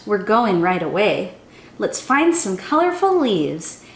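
normal talking voice

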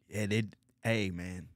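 usual speaking voice

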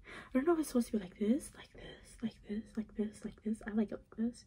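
soft hurried whisper to herself. Real, not acted. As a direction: mutter it under your breath. Whispering while turning the card.

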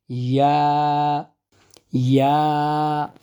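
slow, pressed ইয়া; Arabic ya. Shown as a demonstration. Teacher repeating ya to students, slow. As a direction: stretch ইয়া with the tongue pressed. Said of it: "চাপ দিয়ে উচ্চারণ করতে হবে".

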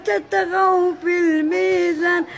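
slow, drawn-out chanted recitation; Quran recitation. Shown as a demonstration. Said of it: "انا ندير واحد المقطع".

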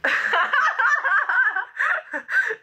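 high, long burst of laughter; real laughter. Real, not acted. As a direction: crack up in high giggles. Cracking up over a comment.

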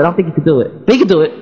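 usual speaking voice

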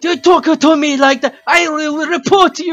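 high, loud, hammed-up angry shout; mock outrage. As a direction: mimic an outraged viewer shouting threats. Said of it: "this guy got so fucking angry".

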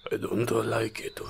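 hushed dream confession; soft unvoiced whisper. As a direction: whisper it, barely voiced. Character speaks inside dream.

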